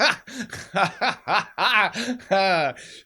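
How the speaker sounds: big wheezing laugh; loud high laugh. Real, not acted. Man laughs hard at his own back story. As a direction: burst out laughing.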